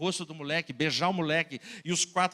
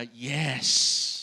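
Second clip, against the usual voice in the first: a sudden louder "Yes!" of triumph; victory cry; 4 semitones lower, 4 dB louder than usual. Acted. Boy alone in his room at dawn, cheering. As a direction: burst out with joy. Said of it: "o meu brado de vitória".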